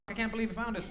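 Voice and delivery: high, soft mock-dismayed voice; dismayed audience member. Comic mimics front-row guests caught out, high mock dismay. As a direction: imitate a startled customer in a small, high voice. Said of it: "you're sitting up front and you're like".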